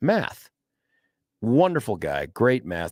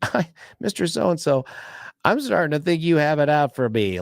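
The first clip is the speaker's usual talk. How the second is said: higher, put-on sweet student voice; coy teen teasing her teacher; mock sweet schoolgirl; 6 semitones higher than usual. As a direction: schoolgirl playing innocent, pitched up. Student voiced in retold story.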